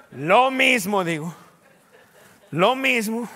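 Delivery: forceful preached repetition; emphatic loud repeat. Acted. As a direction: drive the phrase home to the church. Preacher repeating his reply with force.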